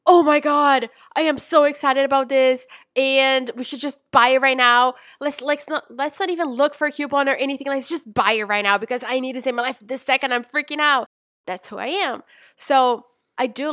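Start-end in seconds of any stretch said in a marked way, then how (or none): 0.1-11.1 overexcited shopper. Retold or imitated. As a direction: blurt it out like you can't wait a second. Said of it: "I'm the kind of person that I'm like".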